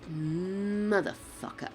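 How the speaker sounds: usual speaking voice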